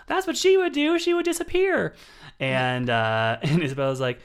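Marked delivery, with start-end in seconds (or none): 0.1-1.9 very high, loud mock exclamation; Taraji P Henson. Podcast host quoting an actress at a roundtable, loud high impression. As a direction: squeal it out as a teasing impression. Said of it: "Taraji P Henson is like".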